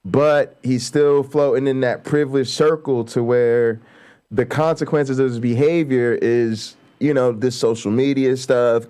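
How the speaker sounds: usual speaking voice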